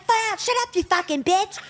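high-pitched crotchety cussing; imitated old smoker lady. Old lady with cigarette cussing out driver, crotchety. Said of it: "in her late eighties, holding a cigarette, smoking".

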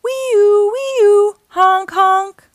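high, sustained siren wail, then honks; imitated siren and horn. A narrator's reading. Fire truck races off to a fire, loud siren imitation.

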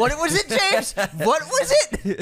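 high, laughing squeal; laughing tease. Real, not acted. Teasing a mispronunciation.

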